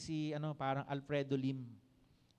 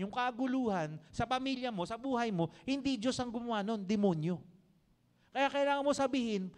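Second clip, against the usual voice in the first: raised, higher, driving sermon voice; fervent preaching; 6 semitones higher, 3 dB louder than usual. Acted. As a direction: preach it out to the whole church. Preacher exhorting the congregation.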